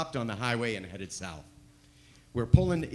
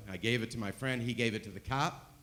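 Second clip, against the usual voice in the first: ordinary talk, nothing marked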